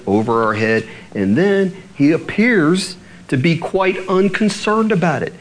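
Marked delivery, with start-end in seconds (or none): 2.1-3.6 "appears" pitched up and drawn out; stress on appears. Real, not acted. Preacher qualifying God's seeming unconcern, "appears" stressed. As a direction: lean slowly on the word "appears". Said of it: "I'm emphasizing the word appears".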